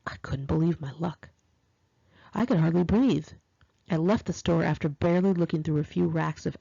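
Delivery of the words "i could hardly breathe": ordinary delivery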